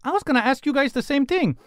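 put-on high-pitched, chatty old lady; kind elderly woman. Elderly newcomer to the party, high friendly voice. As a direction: pitch it up, politely taking charge.

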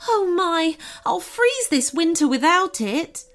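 high, worried goblin voice; storybook goblin in a fluster; distressed goblin. A narrator's reading. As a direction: lament it in high-pitched dismay. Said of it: "said the goblin in distress".

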